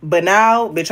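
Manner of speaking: ordinary delivery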